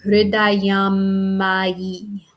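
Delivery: hridayam ma yi with the M held long across both words; long M joined. Shown as a demonstration. Teacher joining hridayam and ma yi with a long M. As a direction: run hridayam into ma yi on one long M. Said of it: "it sounds like".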